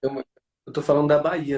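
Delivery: ordinary delivery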